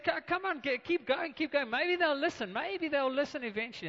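high, teasing egging-on; mocking taunt. Preacher voicing Elijah taunting the Baal prophets, mocking. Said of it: "Elijah starts to mock them".

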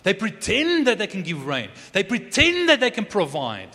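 high, loud proclaiming sermon voice; rising, ringing pulpit cry; full-force preaching. Acted. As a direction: proclaim it to the back row.

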